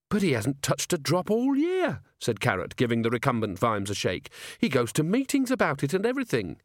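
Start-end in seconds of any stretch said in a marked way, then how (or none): 0.1-2.0 high-pitched, earnest protest. A narrator's reading. Officer defends his slumped commander. 4.6-6.6 high-pitched, earnest insistence; earnest protest. A narrator's reading. Officer defends his slumped commander.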